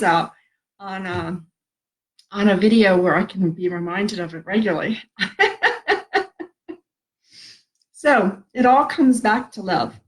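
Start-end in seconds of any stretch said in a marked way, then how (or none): 5.1-6.4 a real high laugh breaking through tears. Real, not acted. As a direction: laugh it off, half crying. Tearing up while speaking on video.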